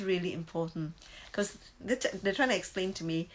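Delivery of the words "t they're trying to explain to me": normal talking voice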